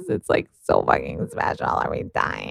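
low, whispery and awestruck; overwhelmed hush. Real, not acted. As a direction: drop to a near-whisper, overcome. Overwhelmed at how special it is.

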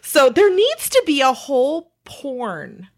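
ordinary delivery

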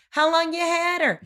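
high-pitched holler at modest volume; mock long-distance shout, held high; yelling across the street. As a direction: call it over to the house opposite. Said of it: "This is me yelling from across the street".

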